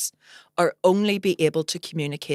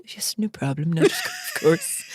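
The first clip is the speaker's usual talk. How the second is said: polite mum voice; 4 dB quieter than usual. Retold or imitated. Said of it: "My mum went".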